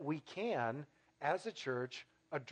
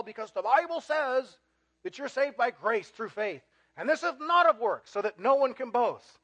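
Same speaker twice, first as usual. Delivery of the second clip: high-pitched, louder mock objection; alarmed objector; 6 semitones higher, 3 dB louder than usual. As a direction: object in a raised, accusing voice. Acted objector accusing the preacher.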